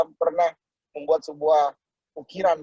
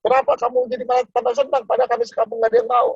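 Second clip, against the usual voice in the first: higher, fast, baffled question; mock puzzled villagers; 8 semitones higher, faster than usual. Villagers asking the cheerful rejected salesman, baffled. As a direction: ask in disbelief at his joy. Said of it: "kata orang sekampung".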